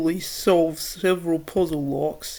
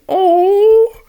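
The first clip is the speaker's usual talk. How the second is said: much higher and louder squealed Oh!; excited squeal; 14 semitones higher, 9 dB louder than usual. Real, not acted. Reader squeals as Pearl Man blushes, excited squeal. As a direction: squeal Oh! with delight.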